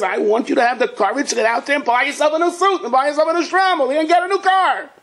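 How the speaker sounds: high, excited rallying cry; elated urging. Acted. God urging a downcast man to go out, elated. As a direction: pitch climbs high as the list builds.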